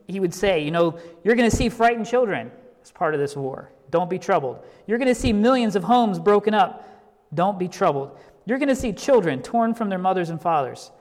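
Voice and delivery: a preacher hammering a refrain to soldiers; preaching at full force. Retold or imitated. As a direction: thunder each scene, then land on "Don't be troubled". Retells Barnhouse's wartime sermon.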